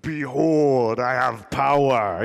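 loud, booming mock-majestic declaration; grand proclamation. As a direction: proclaim it like an all-powerful king, hammed up. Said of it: "I was just like".

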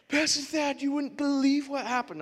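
put-on high excited girl's voice; a teen bursting with news; excited student. Student rushing to tell her pastor, high and eager. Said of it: "one of them coming back".

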